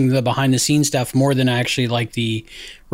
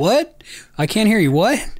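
feigned deafness; 8 semitones higher than usual. Retold or imitated. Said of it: "And I'm like".

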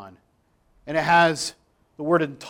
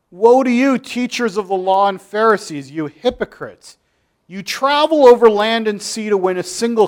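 forceful scripture reading; 3 semitones higher, 6 dB louder than usual. Acted. Preacher to congregation, reading Jesus' rebuke with force. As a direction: thunder the rebuke at the hypocrites.

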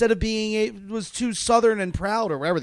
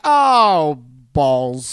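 mock dismay; 4 semitones lower, 5 dB louder than usual. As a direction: groan it out in comic dismay. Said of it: "she's like".

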